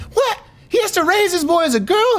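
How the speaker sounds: high-pitched, astonished quote; shocked disbelief. Retold or imitated. Gym buddy hearing of the Texas case, shocked disbelief. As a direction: play the stunned friend, voice shooting up. Retelling friend's shocked reply.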